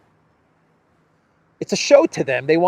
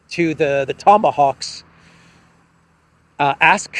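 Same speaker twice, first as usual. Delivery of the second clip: usual speaking voice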